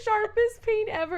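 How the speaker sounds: very high, loud squeal through laughter; laughing protest. Real, not acted. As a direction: insist loudly while cracking up. Laughing protest after fart joke.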